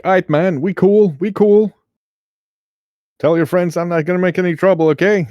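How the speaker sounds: high, easygoing street voice, a bit fast; nervous creature playing it cool; laid-back dude voice. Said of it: "he's like".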